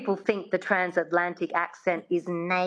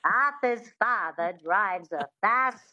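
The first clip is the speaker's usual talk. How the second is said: transatlantic accent carrying the witch's twang; slow, twangy transatlantic delivery. Shown as a demonstration. Coach moving twang into the sentence, transatlantic accent. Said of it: "that twang is really important".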